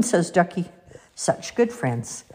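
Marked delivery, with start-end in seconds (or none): none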